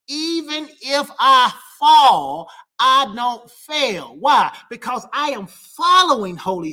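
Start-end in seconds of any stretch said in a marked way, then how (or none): 0.1-4.2 slow emphatic. Real, not acted.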